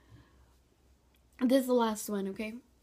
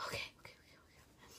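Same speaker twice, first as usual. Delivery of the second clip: whispering; 15 dB quieter, faster than usual. Real, not acted. Bracing, whispered okays.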